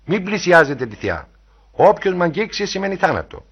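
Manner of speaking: imperious cry to keep back; cried warning. A narrator's reading. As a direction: warn them off loudly. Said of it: "φώναξε".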